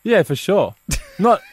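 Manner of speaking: ordinary delivery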